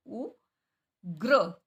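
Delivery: उग्र stretched very slowly with the r clear; drawn-out syllables. Shown as a demonstration. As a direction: draw out उग्र slowly, rolling the र. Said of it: "गै पे रै लगा दिया नीचे".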